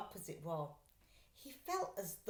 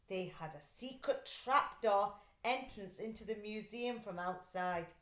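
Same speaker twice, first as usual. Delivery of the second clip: plain reading voice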